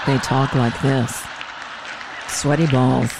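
low, slow radio murmur; hushed public radio host voice; NPR whisper. Shown as a demonstration. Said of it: "they whisper into the mic like I do".

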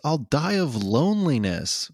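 slow, high, wailing mock lament; drawn-out whiny kid complaint; hammed-up childish despair; Gohan. Retelling Gohan's upset line.

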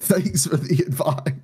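lightly mock the advice with a laugh; sarcastic. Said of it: "And I was like".

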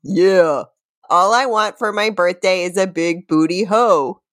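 ordinary delivery

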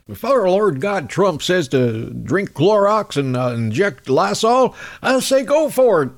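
put-on the southern doctor getting carried away, in his accent. Host rants as the Kentucky surgeon, southern accent. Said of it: "God, that southern accent".

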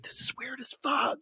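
dad's quiet high aside; aside to son. Retold or imitated. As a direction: lean over and mutter it. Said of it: "turned to me and been like".

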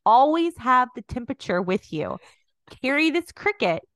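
loud, high huckster sales pitch; salesman pitch. Retold or imitated. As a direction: sell it like a cheesy infomercial. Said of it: "be like".

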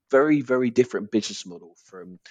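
usual speaking voice

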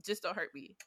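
softly pleading, mildly scared; quiet, half-serious plea for mercy; mock scared. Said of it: "I would be scared too".

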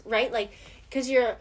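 ordinary talk

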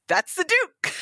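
high-pitched delighted outburst; retold exclamation. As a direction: blurt it out, pitch shooting way up. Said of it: "And I was like".

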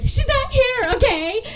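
very high, loud frantic imitation; mock breakdown. Studio owner losing it at the creditor, mock breakdown. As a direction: snap and shriek it, hammed-up. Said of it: "has this total little mental breakdown".

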